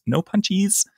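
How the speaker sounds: put-on high, childish whine; mock a whiny kid; whiny baby talk. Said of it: "which is annoying".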